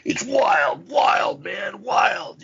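high, hollered mock frontman. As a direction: imitate a metal singer whooping on tape. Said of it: "where Cronus is like".

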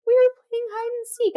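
high-pitched mock tearful little boy; crying child. Scared son explaining to mom, mock tearful child. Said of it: "he comes out crying and he's like".

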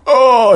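a sharp high yelp of pain; pained groan. Retold or imitated. Teen surfer hit in the ribs, pained high yelp. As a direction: cry out like you just took a hard rib shot.

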